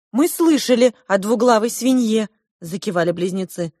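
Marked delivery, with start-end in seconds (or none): 0.1-2.3 higher, louder twin voice; touch of indignant twins; lightly voiced twins. A narrator's reading. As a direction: answer a little high and loud, as the twins. Conjoined twins answer in character.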